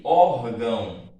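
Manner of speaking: loud, lengthened ÓR in "órgão", weak "gão"; stress on first syllable. Shown as a demonstration. Teacher repeating the example for students, ÓR stressed in "órgão". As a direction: punch ÓR, falling off on "gão". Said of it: "a sílaba forte".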